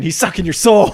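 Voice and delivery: high, loud acted alarm; mock alarmed warning. Dreamer warning friend about the wand, high and loud. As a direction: raise pitch and volume to warn urgently.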